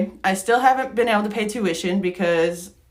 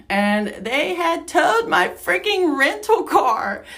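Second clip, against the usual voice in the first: higher and slow, hitting each word in disbelief; exasperated disbelief; 9 semitones higher, slower than usual. Real, not acted. Telling friends the rental got towed, slow exasperated disbelief. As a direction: stretch it out, still can't believe it.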